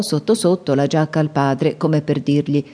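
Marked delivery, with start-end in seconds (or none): none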